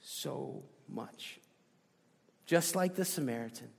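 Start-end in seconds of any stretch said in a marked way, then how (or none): 0.0-1.4 soft, sincere thank you, fading; simple thanks. Retold or imitated. Preacher shows the humble answer to God, softly. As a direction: say it quietly and simply. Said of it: "We simply say".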